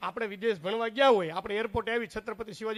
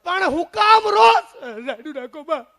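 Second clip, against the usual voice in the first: high, loud, drawn-out yell; exasperated husband shouting, stretched high; 7 semitones higher, 13 dB louder, slower than usual. Acted. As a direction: yell over someone's wailing. Uncle yells at wailing aunt.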